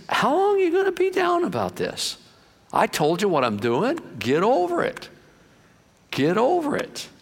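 acted-out impatience, pitch leaping high; high, incredulous telling-off without shouting; exasperated chiding. God chiding Samuel, paraphrased.

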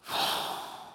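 long wordless breath out; demonstrated sigh. Shown as a demonstration. Preacher showing what the Hebrew word means, a long sigh. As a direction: heave a resigned sigh. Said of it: "that word in the Hebrew means sigh".

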